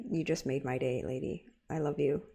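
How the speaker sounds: tender emotional thanks; a touch teary. Real, not acted. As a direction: near tears, quietly. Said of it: "before I get all teary-eyed".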